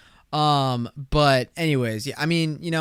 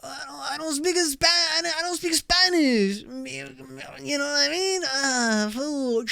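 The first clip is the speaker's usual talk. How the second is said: high-pitched, drawn-out mock confession; embarrassed; 11 semitones higher, slower than usual. Podcaster admitting to people he can't speak the language, embarrassed. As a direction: stretch it out high, slow and squirming. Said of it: "respond out of embarrassment and say".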